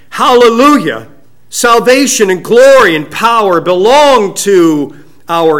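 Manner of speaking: loud, high cry of praise; loud multitude crying. Acted. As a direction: proclaim it like a rejoicing heavenly crowd. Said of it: "a loud voice of a great multitude in heaven, crying".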